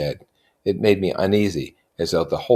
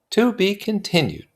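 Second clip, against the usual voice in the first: put-on higher young woman voice; Jordan voice. A narrator's reading. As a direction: lift into a light high voice. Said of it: "she said".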